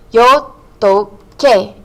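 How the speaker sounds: "Yo toqué" drawn out, syllable by syllable; slow syllable demo. Shown as a demonstration. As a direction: say "Yo toqué" very slowly, separating "to" and "qué". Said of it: "Accent on the E".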